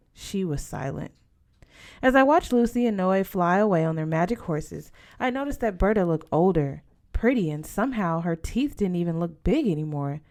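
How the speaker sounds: ordinary delivery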